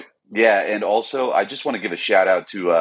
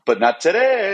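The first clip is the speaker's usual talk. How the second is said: hyped announcement; 10 semitones higher than usual. Acted. Mock announcement to listeners.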